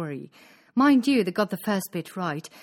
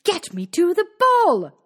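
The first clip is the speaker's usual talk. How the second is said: high, loud demand; demanding shout; 9 semitones higher, 8 dB louder than usual. A narrator's reading. Cindy demands the ball from the fairy, loud shout. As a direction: shout it high and hard, insisting. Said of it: "and shouted".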